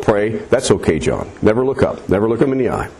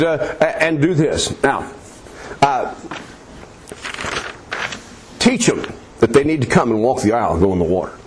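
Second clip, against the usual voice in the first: ordinary delivery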